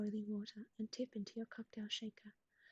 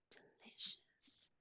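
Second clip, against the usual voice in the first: gasping delight; 6 dB quieter, much slower than usual. Real, not acted. Gasp after reading recipe.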